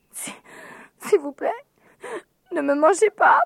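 put-on high, stammering little girl; frightened stammer. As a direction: beg in a scared high voice. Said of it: "bredouilla Sophie". (petite fille qui bredouille de peur)

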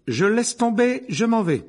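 high, loud, defiant shout; furious outburst. A narrator's reading. Hermione quitting the class for good, furious. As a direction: announce your exit at full voice. Pupil quits class angrily.

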